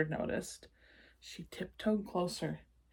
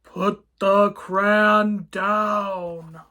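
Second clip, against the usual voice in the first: slow, loud monster growl; 12 dB louder, much slower than usual. Acted. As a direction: drag it out like a looming beast. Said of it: "he growled".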